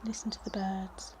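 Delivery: soft hushed whisper. Real, not acted. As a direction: breathe it out so the birds stay undisturbed. Hushing to hear birds.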